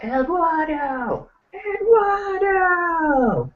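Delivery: high-pitched, drawn-out call into the back; mock yell. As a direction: call out the name high and long, as if from afar. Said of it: "yells in the back".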